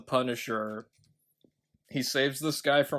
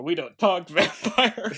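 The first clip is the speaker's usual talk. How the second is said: loud, high, cracking up mid-line; real laughing; 9 semitones higher, 7 dB louder than usual. Real, not acted. Host retrying a silly villain line, bursting into laughter. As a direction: lose it laughing on the quote.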